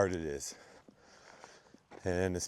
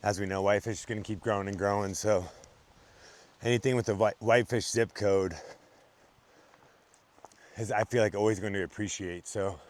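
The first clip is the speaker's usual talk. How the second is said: sales pitch; 4 dB louder than usual. Acted. Pitches a land listing.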